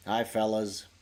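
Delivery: ordinary talk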